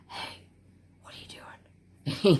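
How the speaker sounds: slow, teasing whisper from behind; sneaky whisper. Retold or imitated. Worker sneaks up behind the boss to startle him, sneaky whisper. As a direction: creep up and whisper it slowly.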